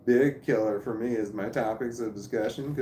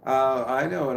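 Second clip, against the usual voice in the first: confessing through a real laugh; 8 semitones higher, 6 dB louder than usual. Real, not acted. Streamer admits three viewers are himself, laughing. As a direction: laugh at your own joke, bursting up high.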